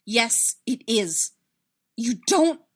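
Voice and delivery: loud contradiction climbing in pitch; agitated retort. A narrator's reading. Agitated character argues back.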